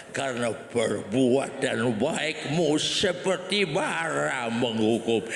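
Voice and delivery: low, slow preachy verse quote; solemn pastor. Acted. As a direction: intone it like a stiff pastor. (gembala membaca ayat dengan kaku)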